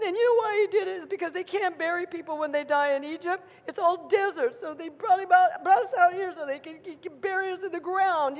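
high-pitched griping; acted whining. People in the tent griping about Moses, high mock whining. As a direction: carry on in a mock whine. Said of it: "carrying on and whining and crying".

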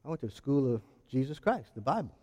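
low, soft, knowing reply; preacher answering about his schooling, low and soft; quiet proud answer. Retold or imitated. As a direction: say it softly with quiet pride. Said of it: "And I go".

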